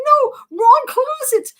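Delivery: high, loud acted correction; frantic protest. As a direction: cry out to stop the misunderstanding.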